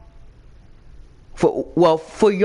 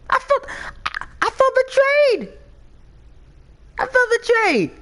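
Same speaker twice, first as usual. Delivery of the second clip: mock betrayed fan.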